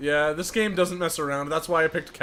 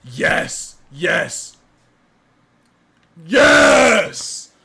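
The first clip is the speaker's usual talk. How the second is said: triumphant yelling; 8 dB louder, much slower than usual. Real, not acted. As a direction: cheer like you just won. Victory celebration.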